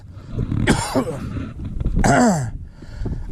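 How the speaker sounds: long unvoiced coughing fit; choking cough. Real, not acted. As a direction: cough on food stuck in the throat. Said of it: "I might die choking on pepperoni sticks".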